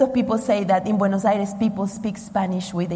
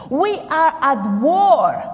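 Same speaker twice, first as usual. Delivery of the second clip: loud, slow, higher-pitched proclamation; emphatic declaration; 4 semitones higher, 6 dB louder, slower than usual. Real, not acted. Preacher warning the congregation they are at war, emphatic. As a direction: proclaim it forcefully, drawing out each word.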